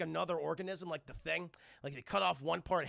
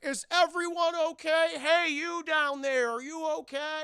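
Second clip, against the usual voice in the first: loud, high, drawn-out holler; hammed-up shout of concern; calling out; 10 semitones higher, 8 dB louder than usual. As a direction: call down to someone far below. Crewmen calling out after a crash.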